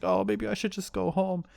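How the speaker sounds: small, high upset voice; high-pitched retold dejection; mock upset. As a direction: imitate a hurt girlfriend wanting out. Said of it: "she's getting upset".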